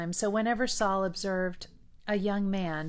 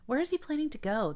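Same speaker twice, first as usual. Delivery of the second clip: quick, high puzzled question; 9 semitones higher, faster than usual. A narrator's reading. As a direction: toss it out fast and high, baffled. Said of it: "were puzzled by this statement".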